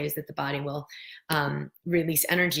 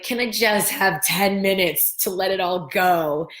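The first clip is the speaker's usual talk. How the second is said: voicing the body; 3 semitones higher, 6 dB louder than usual. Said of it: "the body's like".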